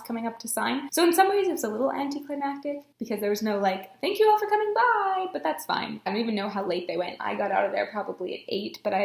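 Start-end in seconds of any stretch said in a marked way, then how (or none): 4.0-5.3 high, loud send-off; singsong-high public thanks; cheery farewell. Retold or imitated. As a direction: imitate a host's cheerful goodbye. Imitating a cheery event farewell.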